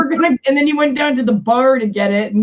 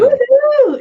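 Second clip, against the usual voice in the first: high, loud whoop; celebratory party holler; excited cheer; 6 dB louder than usual. Real, not acted. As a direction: whoop it up for friends. Cheering as friends greet and drink.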